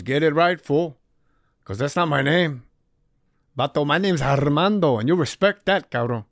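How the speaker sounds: put-on tough Mexican accent, scolding the new guy. Armando scolds him in rehab.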